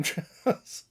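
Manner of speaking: usual speaking voice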